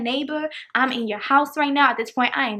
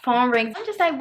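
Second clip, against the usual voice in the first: ordinary talk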